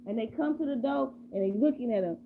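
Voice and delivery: usual speaking voice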